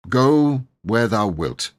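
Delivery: slow, grand pronouncement; nobly. Acted. Knight frees the boy, nobly. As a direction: grant it like a lord. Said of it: "he added nobly".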